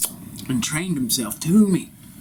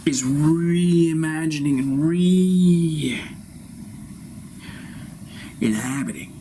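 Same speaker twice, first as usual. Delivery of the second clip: drawn-out proclamation; 3 semitones higher, much slower than usual. Acted. Guru slowly intones his grand revelation. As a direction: stretch every syllable slow and grand.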